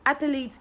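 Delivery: Athlete said as ATH-uh-leet, with an added vowel; three-syllable mispronunciation. Shown as a demonstration. Teacher showing students the wrong three-syllable Athlete. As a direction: Athlete the wrong way, with an extra E sound. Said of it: "you've added E".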